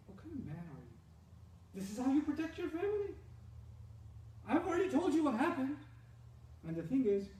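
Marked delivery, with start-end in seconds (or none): 0.1-5.8 wronged wife act. Acted. Wife acting wronged, provoking husband.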